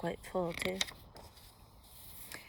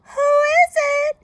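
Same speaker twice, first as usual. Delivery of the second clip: very high, loud fake-granny falsetto; sweetest Granny voice; 18 dB louder than usual. A narrator's reading. Wolf in Granny's bed, fake sweet Granny voice. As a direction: trill it high, posing as Granny. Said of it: "in his sweetest Granny voice".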